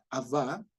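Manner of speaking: "Abba" said ab-BÁ, last syllable stressed; stress on second A. Shown as a demonstration. Teacher shows how it is said, "Abba" stressed as ab-BÁ. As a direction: put the accent on the final A of "Abba". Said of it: "No, no es así".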